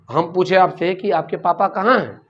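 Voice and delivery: ordinary delivery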